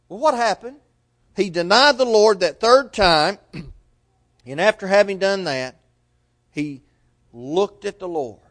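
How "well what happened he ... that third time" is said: ordinary talk, nothing marked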